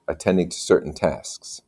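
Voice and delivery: usual speaking voice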